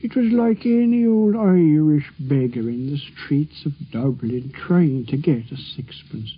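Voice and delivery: drawn-out Irish brogue, like a record played slow; slowed-down imitation of an Irish street beggar. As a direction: imitate an old Irish beggar, dragging the words. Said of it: "if he was put on slow".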